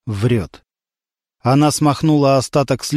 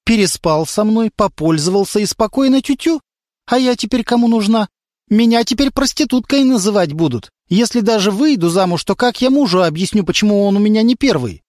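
put-on girl's voice; 7 semitones higher than usual. A narrator's reading. Girl reproaches her lover.

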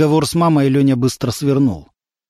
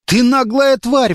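slow, higher outraged insult; 10 semitones higher, slower than usual. A narrator's reading. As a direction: spit it out slowly in outrage. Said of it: "возмутился Лёня". (возмущённо, медленно и выше)

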